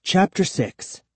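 usual speaking voice

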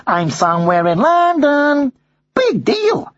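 high, excited bird voice pepping himself up; a parrot giving himself a nervous pep talk; Madison the parrot. A narrator's reading. Madison's inner voice.

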